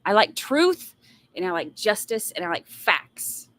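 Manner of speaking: ordinary talk, nothing marked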